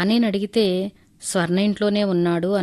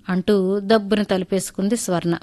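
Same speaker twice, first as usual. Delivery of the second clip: plain reading voice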